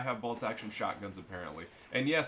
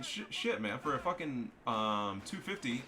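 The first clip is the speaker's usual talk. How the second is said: normal talking voice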